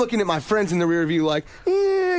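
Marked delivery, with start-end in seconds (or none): 1.7-2.2 panicked whimper. Retold or imitated. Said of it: "freaking out".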